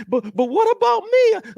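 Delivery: high, whining put-on voice; high-pitched whiny complainer; mock whiny complainer. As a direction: squeak it like a petulant child. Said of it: "And they're like".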